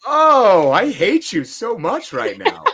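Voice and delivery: high, slow, playful fake hatred; teasing mock disgust; mock outrage. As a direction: pretend to be betrayed by a friend. Friend reveals rival fandom.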